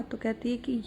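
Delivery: ordinary delivery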